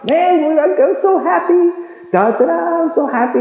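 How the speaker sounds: high, giddy happy voice; happy churchgoer. Retold or imitated. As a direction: bounce it like someone overjoyed. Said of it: "And they're like".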